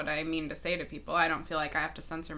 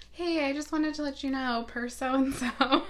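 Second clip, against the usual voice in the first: high, sweet, overly polite office voice; polite help desk email; 7 semitones higher than usual. Retold or imitated. Help desk worker reading her own email aloud, sugary politeness. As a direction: chirp it like a passive-aggressive email. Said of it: "I'm the one who sends that email that's like".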